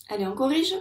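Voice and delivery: normal talking voice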